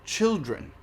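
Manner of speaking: usual speaking voice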